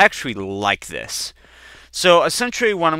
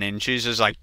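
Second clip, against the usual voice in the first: ordinary delivery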